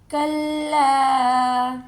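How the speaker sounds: very slow, stretched tilawat; Quran recitation. Shown as a demonstration. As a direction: draw the word out melodically. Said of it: "recitation demo in tajweed lesson". (کھینچ کر تلاوت)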